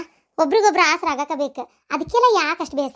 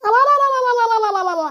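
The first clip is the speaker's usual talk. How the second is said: high, long-drawn rolling "alalala" wail; wailing ululation; 6 semitones higher, 4 dB louder, much slower than usual. Acted. Younger sister seeing gold given away, wailing. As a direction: roll the tongue into a drawn-out lament.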